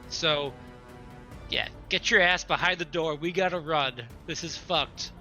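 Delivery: ordinary talk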